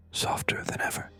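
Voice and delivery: ordinary delivery, nothing marked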